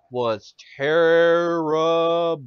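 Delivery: TERRIBLE stretched out slowly syllable by syllable; emphatic verdict. Real, not acted. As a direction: draw out "terrible" very long. Emphatic verdict on bad acting.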